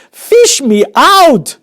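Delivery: very high, loud, slow drawn-out yell; a drowning boy yelling to be fished out. Acted. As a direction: beg to be pulled out. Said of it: "he yelled".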